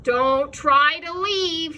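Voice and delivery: slow menacing command; menacing order. A narrator's reading. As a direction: draw it out as a threat. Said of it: "he ordered with a menacing sweep".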